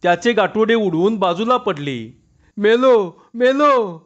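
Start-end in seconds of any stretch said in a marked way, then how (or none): 2.6-4.0 higher, louder cries of pain; a beaten man yelling he is done for; crying out in pain. A narrator's reading. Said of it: "असे म्हणून तो जोराने ओरडला". (वेदनेने जोरात ओरडणे)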